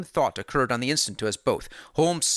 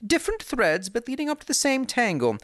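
higher, measured detective voice; calm and knowing; Holmes, cool; 9 semitones higher than usual. A narrator's reading.

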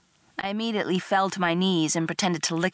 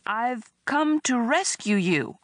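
slow, higher, hesitant declaration; uncertain; 7 semitones higher, slower than usual. A narrator's reading. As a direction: announce it without quite believing it. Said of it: "he said uncertainly".

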